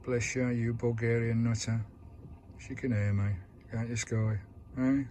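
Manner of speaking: ordinary delivery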